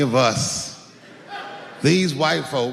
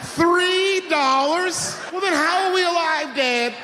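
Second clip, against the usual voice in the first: much higher, very slow, laughing incredulity; outraged disbelief; 12 semitones higher, slower than usual. Retold or imitated. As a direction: stretch out the shock at dad. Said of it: "I was like".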